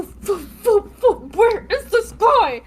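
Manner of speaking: high-pitched, drawn-out mock confusion; puffed swings, then a high, searching question; bewildered swinging fighter. Game master mimicking a player swinging in circles, bewildered.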